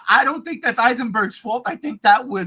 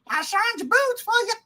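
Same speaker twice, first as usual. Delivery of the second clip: higher, put-on mother's voice; mock Ma Kent; 11 semitones higher than usual. Host parodying Ma Kent's line again. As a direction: exaggerate her sweetness. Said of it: "Ma Kent".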